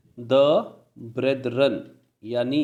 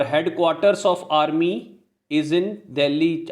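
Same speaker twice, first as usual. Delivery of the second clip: slow, deliberate dictation; teacher dictating slowly; slow example sentence; much slower than usual. Shown as a demonstration. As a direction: read it out word by word. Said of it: "are भी बोल सकते हो".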